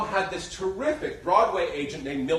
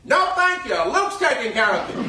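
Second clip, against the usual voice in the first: much higher and louder, brushing off a guest; 9 semitones higher, 8 dB louder than usual. Retold or imitated. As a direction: belt it out like the diva she is. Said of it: "she'd say".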